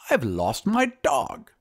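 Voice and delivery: high, slow wizard voice; high wizard announcing a loss; troubled wizard. Acted. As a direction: draw it out as a high-voiced old-tale wizard. Wizard figure announces his loss.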